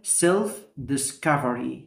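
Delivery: slow, clear self discovery; slow model word. Shown as a demonstration. Teacher modelling self discovery slowly. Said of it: "كلمة مهمة جدًا جدًا".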